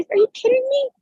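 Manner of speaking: high, disbelieving are you kidding me; incredulous. Real, not acted. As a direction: pitch up in amused disbelief. Incredulous at being told not to cry.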